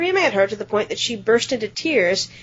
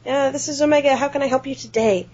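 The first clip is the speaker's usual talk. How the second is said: higher-pitched customer service voice; chirpy shop phone greeting; 5 semitones higher than usual. Retold or imitated. Clerk answers the bookstore phone, higher customer service voice. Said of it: "and I said".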